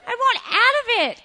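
high, loud desperate plea; pleading near tears. Retold or imitated. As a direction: beg to be heard. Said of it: "I may have started to cry".

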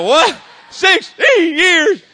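very high, drawn-out incredulous shout; retold shocked yell. Defendant reacts to a sixteen-year sentence, shouting in disbelief. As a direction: shriek it in stunned disbelief, stretching the words.

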